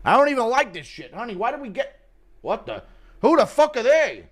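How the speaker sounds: lost and suspicious at home. Pretend.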